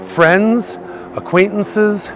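ordinary talk

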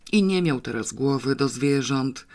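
usual speaking voice

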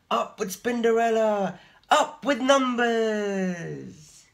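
high loud slow cheer. A narrator's reading. The spiders cheer Spinderella, high and loud. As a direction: cheer it out with joy.

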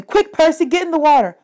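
urgent Annabeth. A narrator's reading. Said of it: "Annabeth told me".

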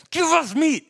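high, loud mock demand; hammed-up shout of demand; demanding grumble. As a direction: yell it like a whining, complaining mob. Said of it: "and they grumbled, and they said".

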